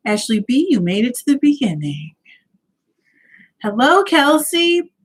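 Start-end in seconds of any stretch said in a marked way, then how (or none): none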